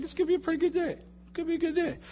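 very high, singsong optimism; mock-cheery falsetto, tickled about the day ahead; chipper self-talk.

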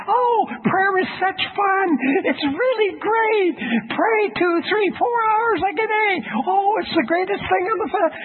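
sugary falsetto raving; mock gushing. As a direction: parody over-enthusiastic prayer books. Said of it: "I read books about prayer and they say".

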